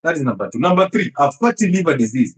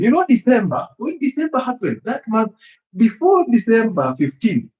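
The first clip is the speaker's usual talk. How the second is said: much higher, playful rising voice; amused excitement; 7 semitones higher than usual. Real, not acted. Doctor joking about December eating, high and playful. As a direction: tease it with delight.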